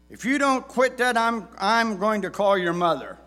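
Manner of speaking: loud, high fatherly threat; threatening dad. Retold or imitated. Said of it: "words that many dads have said".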